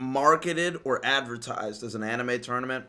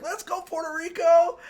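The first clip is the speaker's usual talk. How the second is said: cheering, pitched way up; excited cheer; 14 semitones higher than usual. Retold or imitated. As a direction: hype shout for Puerto Rico. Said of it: "I was like".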